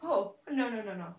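loud frightened gasps, then falling; startled and scrambling; panicking gamer. Real, not acted. Monsters close in at night.

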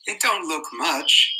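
put-on high, slow doubtful boy. A narrator's reading. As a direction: say it slowly, unconvinced. Piggy doubtful.

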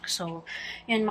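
ordinary talk, nothing marked

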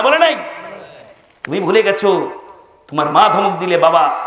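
normal talking voice

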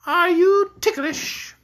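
high, loud, slowing to a drawn-out question; spring the question on a frightened victim; teasing queen. A narrator's reading. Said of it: "she laughed at his frightened look and asked".

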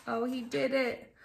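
ordinary delivery

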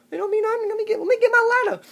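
very high, loud, panicked squeal; frantic squeak. Acted. Short man scrambling for his ladder, shrieking high.

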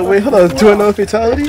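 high, loud mocking voice; mocking imitation. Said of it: "He said".

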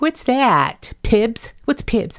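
high-pitched, puzzled mock question; clueless question. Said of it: "I was like".

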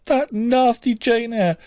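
high, slow, mock-blubbering whine; a whiny boy snivelling to his mamma. Boy tattling to his mother, blubbering. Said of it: "in a blubbering tone".

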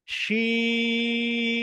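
শিন held long, almost sung; drawn sheen. Shown as a demonstration. Teacher introducing শিন, drawn out. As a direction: stretch শিন with a full sh. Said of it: "তারপর একটা".

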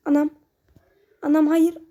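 ordinary delivery

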